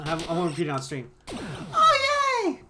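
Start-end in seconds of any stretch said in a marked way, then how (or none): 1.3-2.6 delighted squeal. Real, not acted. Got a snack.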